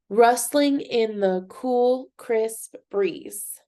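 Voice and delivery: Rustling in the cool, crisp breeze in slow, separate beats; syllable by syllable. Shown as a demonstration. Teacher on the second line, Rustling in the cool, crisp breeze syllable by syllable. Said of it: "Let's look at the second one".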